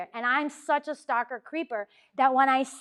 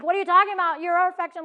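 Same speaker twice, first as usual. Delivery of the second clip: higher, louder incredulous protest; 6 semitones higher, 8 dB louder, faster than usual. Retold or imitated. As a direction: protest in amazement. Protesting to the grieving mom.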